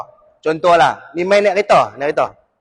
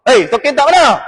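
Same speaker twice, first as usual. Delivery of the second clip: high, scolding call; angry wife; 6 semitones higher, 4 dB louder than usual. Acted. Wife scolds her husband over socks tossed aside, angry. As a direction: scold with pitch pushed up. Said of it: "pun dia marah".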